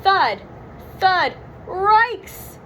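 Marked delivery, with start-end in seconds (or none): 1.7-2.6 high cartoon-dog yelp; Scooby cry. Scooby hears the mummies' thuds, scared dog-voice yelp. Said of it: "Scooby cried".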